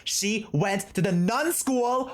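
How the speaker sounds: high-pitched, thrilled discovery; excited gossip. Real, not acted. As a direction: spill hot gossip you just worked out.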